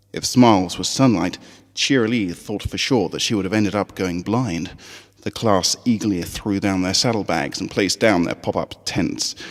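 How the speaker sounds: usual speaking voice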